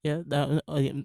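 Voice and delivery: ordinary delivery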